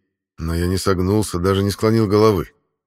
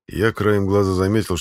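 ordinary delivery

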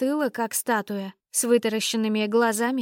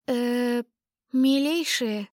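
timid bleat; much slower than usual. Acted. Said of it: "робко проблеяла я".

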